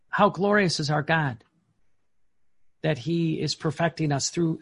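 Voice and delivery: ordinary talk, nothing marked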